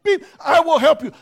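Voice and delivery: impatience boiling over at the till; exasperated plea. Retold or imitated. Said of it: "And I'm just like".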